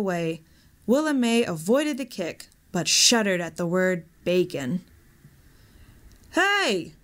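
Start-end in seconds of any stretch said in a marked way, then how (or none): 6.3-6.9 sharp high call of Hey; yell. A narrator's reading. Shorty running up to the thieves, high yell. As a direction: shout to stop the thieves. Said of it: "yelled Shorty".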